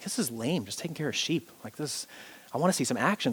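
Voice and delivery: lightly acted quiet complaint; bored boy. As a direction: complain under your breath, mildly fed up. Bored boy grumbling again in story.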